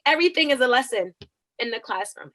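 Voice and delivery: normal talking voice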